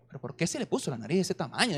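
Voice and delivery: put-on a puzzled young husband asking, soft and quick; softly baffled, fast. Newly married man puzzled by his wife, soft. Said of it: "decía yo".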